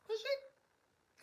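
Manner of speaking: squeaky high questioning "Will she?"; mock high echo. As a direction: echo it back in a tiny high voice. Mocking high echo of own question.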